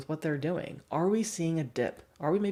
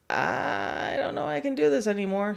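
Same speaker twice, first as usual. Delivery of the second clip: higher, strained dog voice; voicing the tired dog; 8 semitones higher than usual. Trainer voicing a dog tired of sitting up, higher and strained. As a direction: give up gently, tired. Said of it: "they're like".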